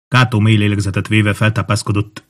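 ordinary delivery

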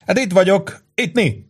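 rowdy barroom buddy; boisterous Angelo. A narrator's reading. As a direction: hail a friend across a packed bar. Angelo voiced across bar.

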